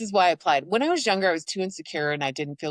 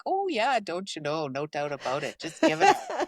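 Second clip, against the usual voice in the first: exaggerated Canadian accent. Imitating herself with another Canadian, hammed-up Canadian accent. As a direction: put on a jokey broad Canadian accent. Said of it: "when I get with another Canadian".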